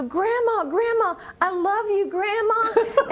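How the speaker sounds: put-on high, loud, sing-song call of a young girl; girl hollering Grandma across the prison yard. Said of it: "she'd holler out".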